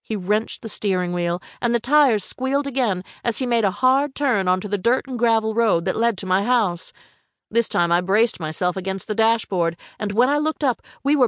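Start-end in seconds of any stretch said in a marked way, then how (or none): none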